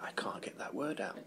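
soft, voiceless aside; whispered mock struggle. Actor acting out a struggle with a tricky word, whispered. Acting out being tongue-tied.

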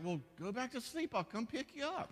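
high, humoring voice; husband humoring his wife at night, high and mock gentle; mock reassuring husband. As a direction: soothe her back to sleep, half joking. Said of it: "I'm, I'm like".

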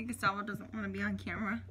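ordinary talk, nothing marked

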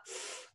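the bare consonant "f", with no vowel after it; isolated F sound. Shown as a demonstration. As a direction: sound out "f" on its own. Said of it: "It makes that f sound".

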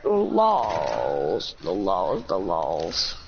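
very low mock voice; deep voice. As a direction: drop way down low.

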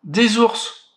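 put-on a child waking up to bears; frightened cry. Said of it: "s'écrie-t-elle effrayée".

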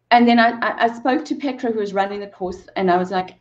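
usual speaking voice

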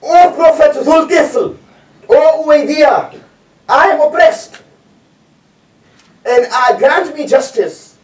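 high, slow pleading call for justice; mock shouted plea. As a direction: cry out to the prophet, drawn out and pleading. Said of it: "a person shouted, screamed".

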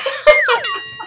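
short high-pitched giggle. Real, not acted. Laughing at cartoon.

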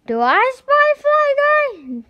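put-on very high, loud hopeful boy; hopeful call. Boy asking the fly in his hand, very high and loud. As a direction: call it out, sure this time.

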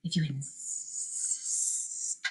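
low, slow, very soft snake hiss. A narrator's reading. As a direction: stretch the s into a long quiet hiss. Boa constrictor answering the challenge.

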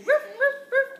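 high, loud playful barking; dog barks. Shown as a demonstration. Mom showing the baby how a dog barks, loud mock bark. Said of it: "A dog says".

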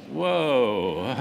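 a loud, startled "whoa" that tips into laughter; teacher hit by an unexpected question, laughing it off. Retold or imitated. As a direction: caught off guard, laughing. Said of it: "She's like".

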